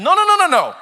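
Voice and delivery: high, loud run of no's; rapid-fire comic protest; emphatic denial. Acted. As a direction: wave the idea off in mock horror. Mock denial of worse scandal.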